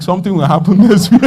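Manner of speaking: quick words breaking into laughter; laughing. Real, not acted. Preacher telling on himself about weeks of crying, laughing. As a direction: laugh through the line. Laughs at his own past hope.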